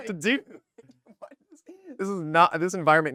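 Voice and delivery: laughing scold. Retold or imitated. I'm just.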